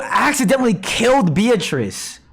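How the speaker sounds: high, rushed excitement; like it just clicked; excited realization. Real, not acted. Realizing a plot twist.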